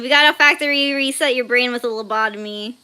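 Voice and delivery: usual speaking voice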